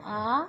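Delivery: "आ" held for double length; a long, sustained "आ"; long vowel. Shown as a demonstration. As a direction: stretch "आ" out evenly. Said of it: "दो गुना मतलब double time".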